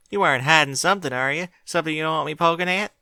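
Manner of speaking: put-on young filly pressing her sister, quick and high; suspicious Apple Bloom. A narrator's reading. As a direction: kid sister prying, high and fast.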